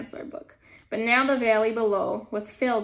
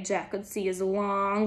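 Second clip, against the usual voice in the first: usual speaking voice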